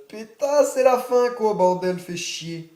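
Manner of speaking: high, loud frustrated cry; frustrated outburst. Real, not acted. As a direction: blurt it out in frustration. Record run ends, frustrated outburst.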